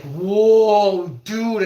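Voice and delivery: loud, high startled whoa; alarmed whoa. Retold or imitated. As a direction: blurt it as the vision goes. Vision blurring in bath.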